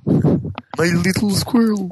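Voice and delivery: high, slow, put-on cartoon voice; playful high toon impression; cartoon character voice. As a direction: draw it out high and silly, joining in the impression.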